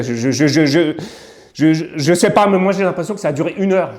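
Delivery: stumbling over words, pitch climbing; stammering, distressed insistence; agitated stammering. Real, not acted. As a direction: struggle to get it out, voice rising high. Stammers recalling endless wait.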